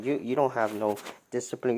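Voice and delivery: ordinary talk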